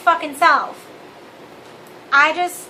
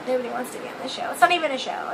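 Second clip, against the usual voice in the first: quiet laugh; 3 semitones lower, 6 dB quieter, much faster than usual. Real, not acted. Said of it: "self-deprecating laugh".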